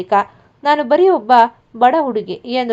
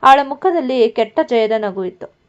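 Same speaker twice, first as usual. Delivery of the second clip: usual speaking voice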